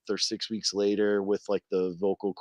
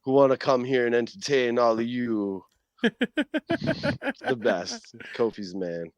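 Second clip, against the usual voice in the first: put-on Jamaican accent; 3 semitones higher than usual. Toy Kofi Kingston greets the crowd in a Jamaican accent. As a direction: boom a playful Jamaican hype line. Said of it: "the thick boom boom Jamaican accent".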